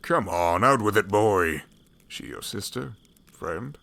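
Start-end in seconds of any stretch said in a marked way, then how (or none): 0.0-1.6 loud, booming demand; a gruff old man loudly presses the shy boy to talk; loud impatient push. Acted. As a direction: bellow it, push him to talk.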